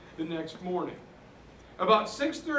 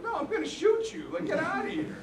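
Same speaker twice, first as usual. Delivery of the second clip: mock defiant refusal; 9 semitones higher, faster than usual. Retelling Tom refusing German soldier.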